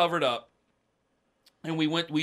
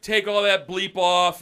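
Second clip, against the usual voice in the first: higher, louder, slowed dad's order; imitated stern dad; 5 semitones higher, 7 dB louder, slower than usual. Dad ordering muddy son to strip, mildly angry imitation. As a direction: order him like a fed-up dad.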